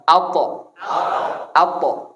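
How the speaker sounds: "Alto" with a thick, rounded, heavy t; heavy Arabic to. Shown as a demonstration. Teacher demonstrates the heavy to, mouth rounded. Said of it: "to itu tebel".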